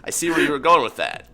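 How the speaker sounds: loud, smug villain gloat. Acted. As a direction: gloat at the company you just blocked. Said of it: "stop a company dead like".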